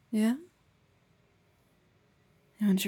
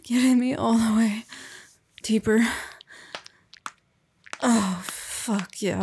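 loud moaning; 5 dB louder than usual. Acted. Moaning in climax.

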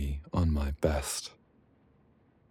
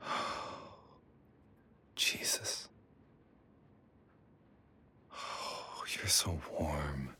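whispering; 11 dB quieter, slower than usual. Acted. Intimate whisper after climax.

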